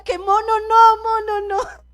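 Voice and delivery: a hint of crying in a frantic prayer; retold crying plea. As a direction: beg through pretend tears in the dream. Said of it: "y que yo lloraba y yo decía".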